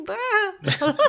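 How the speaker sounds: high laughing "Ah!"; laughing yelp. Retold or imitated. GM voicing cultists startled again, high laughing yelp. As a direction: squeal it through a laugh.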